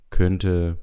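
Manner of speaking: ordinary talk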